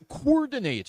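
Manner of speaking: "coordinate" with the double O as one "koor" sound; merged vowels mispronunciation. Shown as a demonstration. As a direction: misread "coordinate" as "koor-dinate". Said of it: "Who wants to say coordinate".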